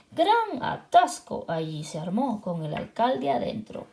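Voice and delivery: ordinary delivery, nothing marked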